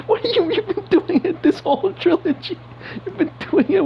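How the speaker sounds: exasperated, voice leaping up; incredulous outburst. Real, not acted. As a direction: pitch jumps high in disbelief. Exasperated rant about MJ.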